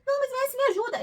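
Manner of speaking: high, coaxing plea to the husband; pleading. Retold or imitated. (pedindo com voz manhosa e aguda)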